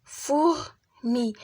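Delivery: "فوغ", pause, "مي"; "فوغ" held apart from "مي"; split into syllables. Shown as a demonstration. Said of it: "كنقراوها".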